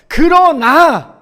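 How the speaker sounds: 그러나 loud, high and stretched very slowly; stress on 그러나. Shown as a demonstration. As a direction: draw out 그러나 syllable by syllable. Key word of the text.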